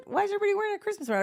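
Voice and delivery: high, fast, playing dumb; mock innocent brother. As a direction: squeak it out fast like a clueless guy. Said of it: "he goes".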